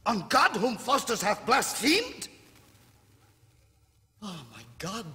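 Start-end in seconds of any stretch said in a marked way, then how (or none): 0.1-2.3 much higher and louder, crying out; anguished cry. Acted. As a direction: escalate into a desperate cry.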